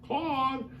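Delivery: high, carrying call of Claude's name; calling for the cat. Retold or imitated. Mrs Mack calling up into the attic for her cat, high and searching. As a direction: sing out the cat's name up high like a worried owner. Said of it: "She's like".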